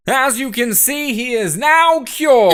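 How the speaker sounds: slow, loud showman announcement; grand proclamation. Acted. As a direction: proclaim the cure to the crowd.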